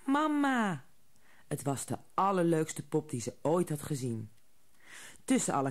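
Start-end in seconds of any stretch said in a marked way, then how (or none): 0.1-0.8 high little doll voice; tiny doll voice. A narrator's reading. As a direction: squeak it like a talking doll. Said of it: "zei een stemmetje". (hoog pop-stemmetje)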